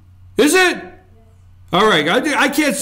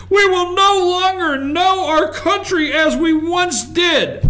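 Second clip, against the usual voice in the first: hammed-up alarm, shouted higher; mocking yell; 6 semitones higher than usual. Mocking critics who kept yelling, in a high hammed-up shout. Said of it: "They kept yelling and yelling".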